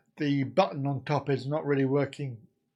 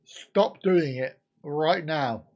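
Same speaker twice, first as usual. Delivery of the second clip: ordinary delivery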